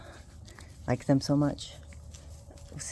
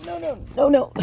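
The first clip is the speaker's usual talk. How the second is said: high alarmed no; 11 semitones higher, 5 dB louder than usual. Real, not acted. Puppy escaping.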